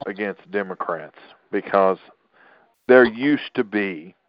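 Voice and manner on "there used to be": "used" drawn out and louder; stress on used. Real, not acted. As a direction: lean hard on "used", stretching it slowly. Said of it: "I stress the word used".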